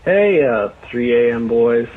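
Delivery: ordinary talk, nothing marked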